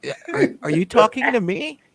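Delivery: high, loud, incredulous are you talking to me; a retold bewildered yell; mock confused outburst. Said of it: "this is exactly what I did. You ready?".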